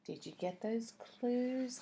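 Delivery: ordinary delivery, nothing marked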